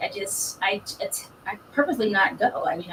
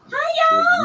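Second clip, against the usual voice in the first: excited high greeting; 7 dB louder than usual. Real, not acted. Guest greets audience.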